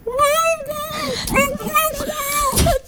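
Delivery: high squealing laugh. Shown as a demonstration. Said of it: "한번 해보세요".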